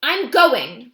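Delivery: put-on firm, loud child's insistence; Ruby insists to Akello that she's going; determined. A narrator's reading. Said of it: "in her most determined voice".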